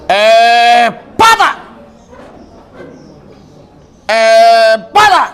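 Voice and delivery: high, loud commanding shout; loud, high healing command. Real, not acted. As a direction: bark the command to break. Commanding the illness to break.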